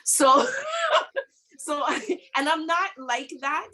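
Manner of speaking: laughing. Real, not acted. Laughing about panicking.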